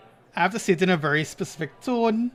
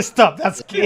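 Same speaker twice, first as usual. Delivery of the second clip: raised, louder scolding dad; mock stern dad; 7 dB louder, slower than usual. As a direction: imitate a comedian's dad telling off his son. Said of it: "over the boy head and say".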